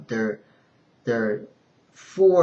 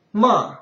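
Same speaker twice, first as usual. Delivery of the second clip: MA falling hard and loud from high; fourth tone; 9 semitones higher, 7 dB louder than usual. Shown as a demonstration. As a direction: drop MA sharply from the top.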